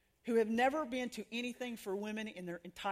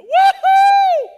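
huge high, loud cheer; joyful whoop; 21 dB louder than usual. Retold or imitated. Watching the rescued woman's bus pull away, ecstatic shout. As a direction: let out a wild whoop of joy. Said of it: "And I just went".

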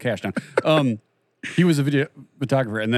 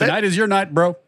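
higher, louder hyped-up quote; Twins movie quote; 9 semitones higher, 5 dB louder than usual. Retold or imitated. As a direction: pump up the groom like the film. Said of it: "That's from Twins".

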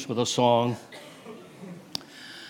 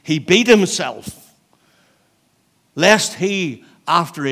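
louder, higher burst of preaching; emphatic; 6 semitones higher, 10 dB louder than usual. Real, not acted. Preacher stressing Paul's warning, emphatic burst. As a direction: punch it out to the church.

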